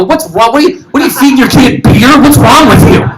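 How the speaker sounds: high, rising disbelief; mock outrage at a friend; incredulous. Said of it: "I go".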